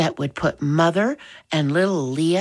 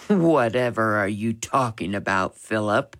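lower, lightly amused father's voice; chuckling father; 5 semitones lower than usual. A narrator's reading. Father to his defiant son, lightly amused. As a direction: drop low, as if mildly amused. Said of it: "Father chuckled".

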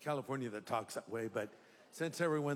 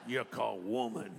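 hammed-up accent of the American South; Southern accent; 3 semitones lower than usual. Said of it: "like he was from the South".

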